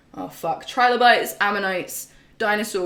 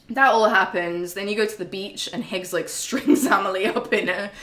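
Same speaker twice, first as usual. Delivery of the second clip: usual speaking voice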